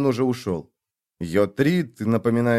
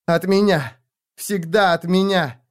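high, slowed bitter cry; anguished insistence on меня; bitter shout; 8 semitones higher, 3 dB louder, slower than usual. Acted. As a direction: spit it out in resentment. Bitter shouting after exhale.

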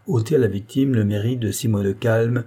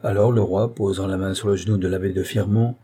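ordinary delivery, nothing marked

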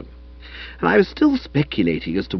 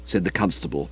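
ordinary delivery, nothing marked

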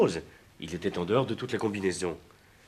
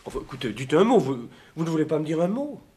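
raised pleading; 7 semitones higher, 5 dB louder than usual. Acted. Pleading for an answer.

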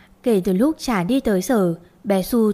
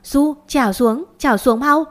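higher, louder alarmed shout; 5 semitones higher, 4 dB louder than usual. Acted. Mother running down the stairs, shouting at the climbing child in alarm. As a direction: yell a frantic warning to the child. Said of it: "vừa chạy tôi vừa hét lên".

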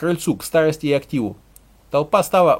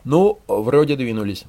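ordinary delivery, nothing marked

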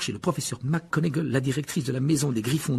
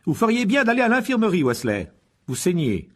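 higher, louder, firm instruction; brisk stern teacher; 8 semitones higher, 5 dB louder than usual. A narrator's reading. The professor sends the bleeding boy away, briskly. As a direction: order him off to the nurse. Voices McGonagall.

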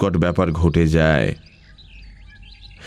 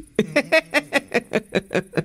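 high, staccato acted laugh; long laughter; 4 dB quieter than usual. Pramatha laughing off the learned retort, a long high laugh. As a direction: keep laughing in high, quick bursts. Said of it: "Pramatha হাসতে লাগল".

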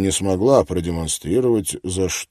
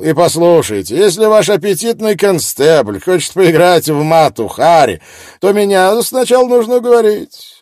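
brash reporter; 10 semitones higher, 9 dB louder than usual. Acted. Miller gloating, loud.

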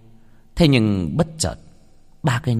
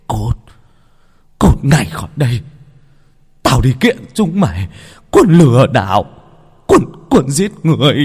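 loud, outraged tirade; angry accusations hurled at full force; furious shouting; 4 semitones higher, 8 dB louder than usual. Acted. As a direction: roar them out of the house. Father throws out the man he punched.

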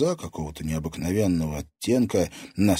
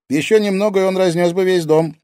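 put-on high, loud teenage boy; lively, high-pitched boy; boyish character voice; 13 semitones higher, 8 dB louder than usual. A narrator's reading. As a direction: exclaim it in a ringing lad's voice. Boy's character voice in dialogue.

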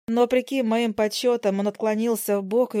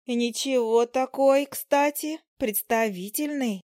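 slow admiring fly; 3 semitones higher, slower than usual. Acted. Fly admires fallen male fly.

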